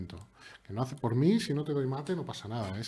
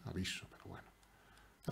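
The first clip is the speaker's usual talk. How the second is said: whispered aside; 5 semitones lower, 13 dB quieter than usual. Real, not acted.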